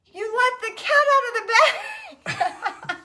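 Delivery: high, slow, laughing blurt; laughing punchline. Retold or imitated. Retelling her pun to the couple with the cat, high and laughing. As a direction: blurt the joke out through laughter. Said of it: "out of my mouth goes".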